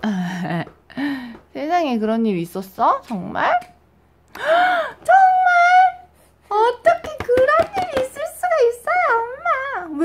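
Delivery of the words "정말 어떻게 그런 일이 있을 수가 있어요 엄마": much higher, louder mock-crying baby voice; voicing the upset baby. Mother voices her baby complaining about the chair, mock-crying high. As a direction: wail the baby's complaint to mom.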